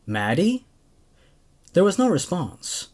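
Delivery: steady, even storytelling read; story narration. A narrator's reading. Host reading a creepypasta to his viewers, calm narration. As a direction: carry the tale along calmly.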